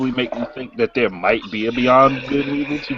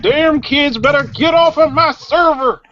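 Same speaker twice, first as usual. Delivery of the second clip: back in my day rant; 4 dB louder, slower than usual. Retold or imitated. Cranky old man impression.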